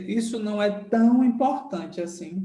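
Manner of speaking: usual speaking voice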